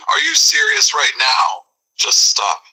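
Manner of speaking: high whispered disbelief; pleading, out of patience; exasperated. Acted. Exasperated man pleading with the offender, high. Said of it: "He is beyond exasperated now".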